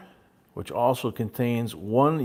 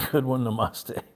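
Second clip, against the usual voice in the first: ordinary delivery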